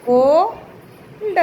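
Cold very slow, a long "ko", pause, then "lda"; sounded out. Shown as a demonstration. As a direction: stretch the first syllable of cold and detach the ending. Teacher sounds out word for writing.

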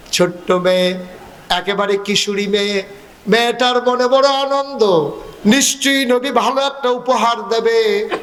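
usual speaking voice

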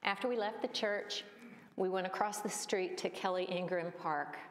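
usual speaking voice

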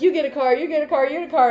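excited, high-pitched giveaway chant; Oprah. Acted. Talk-show host giving cars away, excited impression. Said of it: "You know how Oprah's like".

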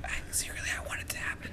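secret unvoiced whisper. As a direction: whisper it like a confession. Confessing a secret wish.

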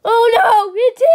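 loud high panicked cry; scared shout. Acted. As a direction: panic as he appears. Mob appears.

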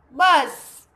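a loud, drawn-out buzz like a bee; buzzing bee sound. Shown as a demonstration. Teacher voicing a bee sound. (मधुमक्खी जैसी ज़ोरदार भनभनाहट)

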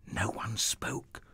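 near-whispered suspense; hushed. A narrator's reading. Narrator after the giant's challenge goes unanswered, hushed. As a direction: drop to a breath of a voice.

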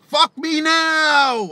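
high, slow, drawn-out "Fuck me now!"; repeated screamed demand. Retold or imitated. Retelling the Bulgarian girl's demand, high drawn-out yell. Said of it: "He was like".

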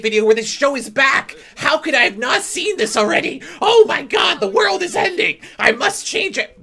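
high, loud shrieking fan freak-out; over-the-top sarcastic frenzy; mock hysteria. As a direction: scream it like the world is ending.

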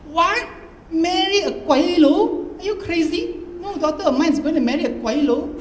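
high, loud, furious objection; Dad angrily forbidding the marriage, high and loud; angry outburst. Acted. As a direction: explode at the daughter. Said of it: "and so angrily it took us all by surprise".

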